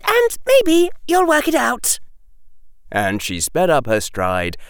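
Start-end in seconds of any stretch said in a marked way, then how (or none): 0.0-2.0 put-on high, loud, exasperated girl; sharp, high-pitched retort; snapped. Hermione leaving Harry gaping behind her, snapping. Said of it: "Hermione snapped".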